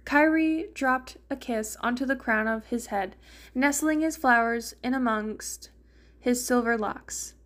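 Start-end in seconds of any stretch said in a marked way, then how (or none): none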